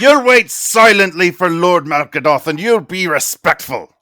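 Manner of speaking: loud, harsh guard's warning; guard threatening the prisoners, loud and harsh; menacing guard. Acted. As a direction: growl the order like a brute guard.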